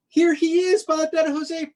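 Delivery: high, loud cry of delight; thrilled kid spotting the ice cream man; excited announcement. Acted. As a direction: herald his arrival with joy. Excited arrival of vendor.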